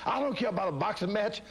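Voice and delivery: very high, strained proclamation; ecstatic shout. Retold or imitated. Fighter shouting down his trainers, ecstatic. Continued delirious screaming.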